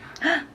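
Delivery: high excited gasp. Acted. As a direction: gasp with delight. Reading picture book aloud.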